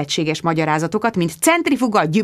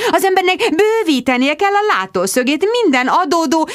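high, breezy, dismissive mum voice; airy mother; 5 semitones higher than usual. Acted. Mother waving away the Julio affair, high and breezy. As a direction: brush it all off lightly, high and airy. Mother defends her friendship.

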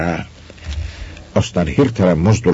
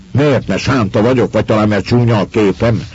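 louder, higher, cutting retort; sharp and hostile; 6 semitones higher, 4 dB louder than usual. A narrator's reading. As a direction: bite back with hostile sharpness. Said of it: "hangja ellenségesen megélesedett".

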